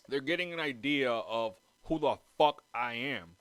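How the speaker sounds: ordinary talk, nothing marked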